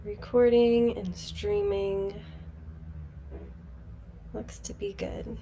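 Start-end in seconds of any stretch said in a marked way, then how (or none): 4.3-5.4 muttered under the breath to herself; whispered aside. Real, not acted. Host checking her stream settings, whispering to herself.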